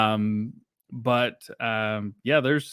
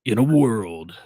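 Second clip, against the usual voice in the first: movie announcer voice; faster than usual. Pretend. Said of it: "movie announcer voice".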